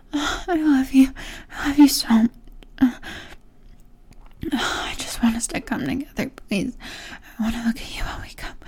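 ordinary delivery

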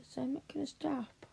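put-on teasing whispered threat to her baby girl. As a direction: let the threat drop to a whisper. Threat in role.

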